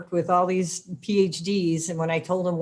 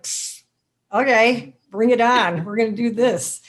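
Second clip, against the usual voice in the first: louder, higher, challenge-accepting self-talk; defiant bring it on; 4 semitones higher, 5 dB louder than usual. Retold or imitated. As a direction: shrug it off, then square up to the challenge. Defiant self-talk against a curveball.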